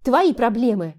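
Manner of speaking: sharp angry retort; snapping. Acted. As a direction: snap back at him. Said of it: "огрызнулась она".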